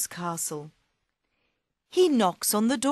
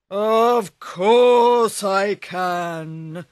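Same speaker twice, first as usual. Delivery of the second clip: very slow, loud ogre boast; boastful ogre; 9 dB louder, much slower than usual. Acted. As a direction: drag each word out proudly.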